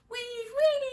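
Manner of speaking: very high squeaky voice calling up; high little Who mayor calling out; tiny mayor calling. Acted. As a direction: call up from a speck, tiny and high. Mayor voice again.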